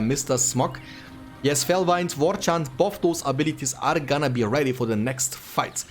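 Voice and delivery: ordinary delivery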